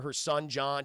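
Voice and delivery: ordinary delivery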